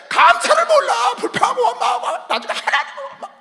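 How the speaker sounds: high, loud indignant sermon; full-force preaching. Real, not acted. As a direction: pile up the reproaches, climbing to a shout. (격앙되어 외치는 설교)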